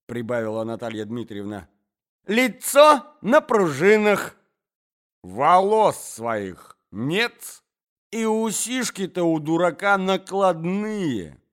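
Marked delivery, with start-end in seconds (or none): 2.3-11.4 скрипучая злоба. Acted. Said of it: "скрипила Марья Александровна".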